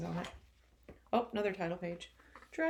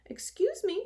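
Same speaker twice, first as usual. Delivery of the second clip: much higher, tiny cricket voice; polite cricket; 13 semitones higher, 6 dB louder than usual. Acted. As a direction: squeak it like a small polite insect. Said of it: "coughed a cricket".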